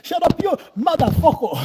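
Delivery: loud, high joking shout at Leo; loud teasing shout; loud mock insult. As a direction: bark a friendly insult across the gym. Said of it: "those people".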